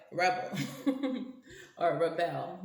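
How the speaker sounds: giggling through the word rebel; laughing. Real, not acted. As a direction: laugh it out, amused by the card.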